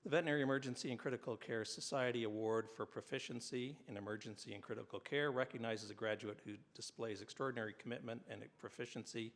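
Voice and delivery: formal ceremony announcer; award announcer. Acted. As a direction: read it like an awards presenter. Reads award citations at ceremony.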